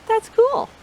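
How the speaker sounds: high, delighted exclamation; excited. Real, not acted. Reader thrilled by spider fact.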